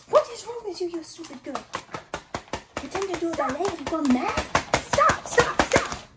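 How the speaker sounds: furious shrieking tirade; wicked stepmother. Acted. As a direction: scream at her like a wicked stepmother. [name] explodes at ballet pretend.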